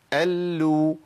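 "ال" on a level note, L held then released into u; doubled lam, damma. Shown as a demonstration. Teacher runs through the cases, doubled L with damma. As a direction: sustain the L of "ال", release into u. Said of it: "المشدده".

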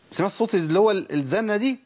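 usual speaking voice

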